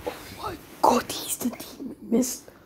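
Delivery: hushed gasp of recognition; awed whisper. Acted. Onlookers recognise the demon mist, shocked whisper.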